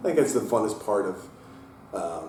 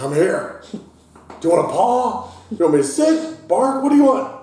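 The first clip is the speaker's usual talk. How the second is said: eager dog voice; 14 semitones higher, 12 dB louder than usual. Pretend. Dog Willow voiced begging treats.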